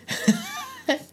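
a burst of high, breathless laughter; high airy laugh. Real, not acted. Host cracks up at the guest's story, high breathless laugh.

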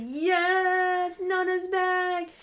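high, drawn-out squeal of delight; thrilled, stretched-out fan squeal; excited cheer. Retold or imitated. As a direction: cheer like your favourite character just walked back in. Cheering Nona's return in the book.

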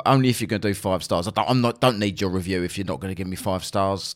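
usual speaking voice

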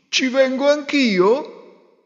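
high, slow goose voice; slow pleading goose. Acted. Goose asks to come.

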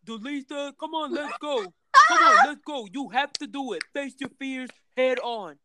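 high, loud, pushy client urging her on; imitating the client pushing her onto the ride; client voiced. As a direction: mimic the eager client hyping her up. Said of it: "my client like".

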